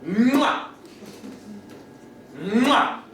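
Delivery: high rising "mwah" yelp; sudden high-pitched mad noise, imitating a stranger; imitated crazy outburst. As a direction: burst out with a high, nonsense smack-yelp.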